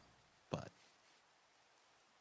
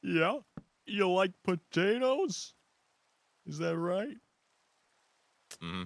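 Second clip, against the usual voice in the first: Joe-like voice; 7 semitones higher, 6 dB louder than usual. Pretend. Quoting the abridged anime to friends, loud cartoon cop bark. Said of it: "You sound like ... Family Guy. Joe".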